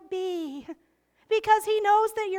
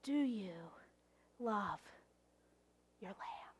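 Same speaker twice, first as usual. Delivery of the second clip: hushed, intimate whisper; 8 semitones lower, 12 dB quieter than usual. Acted. Pastor asking the congregation, whispered. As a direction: whisper the question close. Repeated question, now whispered.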